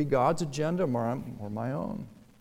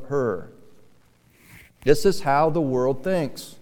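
loud, emphatic sermon voice; forceful preaching; 3 semitones higher, 7 dB louder than usual. Real, not acted. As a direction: preach it out to the congregation.

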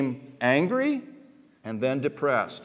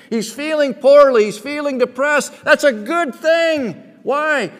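high, loud preaching climax; fiery sermon peak; full-force preaching; 11 semitones higher, 10 dB louder than usual. Real, not acted. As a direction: drive it home to the congregation.

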